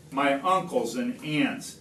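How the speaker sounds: ordinary delivery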